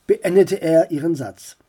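plain reading voice

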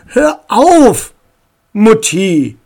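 high, loud cut-in; exasperated interruption. Acted. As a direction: stop your mother mid-sentence. Daughter cuts off her mother.

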